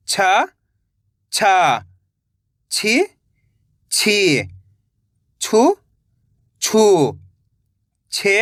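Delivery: each syllable said alone, slow and clear, with a pause after it; syllable drill. Shown as a demonstration. Teacher drilling students on the barakhadi, clear syllable recitation. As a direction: recite syllables for the class to repeat. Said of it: "जिस तरीके से मैं एक एक अक्षर का उच्चारण कर रहा हूँ".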